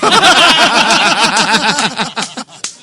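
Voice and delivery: loud, high, long laugh; helpless laughter. Real, not acted. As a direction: burst out laughing and keep going. Cracks up at Batman and Robin.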